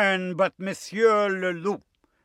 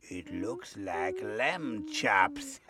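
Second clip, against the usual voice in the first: low, slow wolfish relish, then a laugh; hungry wolf; 6 semitones lower, slower than usual. Acted. Wolf peeks at the sheep through window, hungry relish. Wolf eyeing his dinner.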